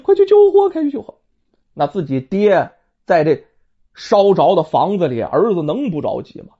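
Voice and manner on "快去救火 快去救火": high, urgent cry that fire broke out; shouting for help. Acted. Stepmother crying out to her stepson that the house burns, alarmed. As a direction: call out in alarm, voice climbing high. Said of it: "然后喊".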